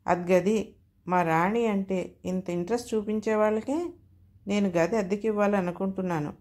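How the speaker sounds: ordinary delivery, nothing marked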